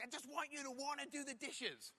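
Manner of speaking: high-pitched upset whine, lightly acted; mock crying. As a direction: imitate a tearful plea, pitched well up. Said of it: "she's crying and she's like".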